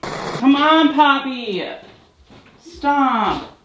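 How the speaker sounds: high, loud angry scolding; real fury at the cats for interrupting; angry yell. Real, not acted. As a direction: vent at the cats in real anger. Yelling at cat.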